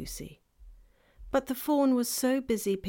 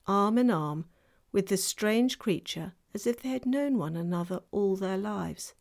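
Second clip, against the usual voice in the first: ordinary delivery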